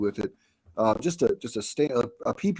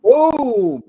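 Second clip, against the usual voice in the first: sudden high, loud shout of triumph; excited boom; 12 semitones higher, 9 dB louder than usual. Real, not acted. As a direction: blurt it out like a game-show buzzer. Host confirms a correct answer.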